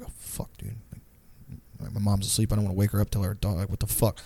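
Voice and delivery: low, barely voiced muttering; retold worried undertone; hushed dismay. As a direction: keep it down, someone is sleeping. Dismayed, mom is asleep nearby.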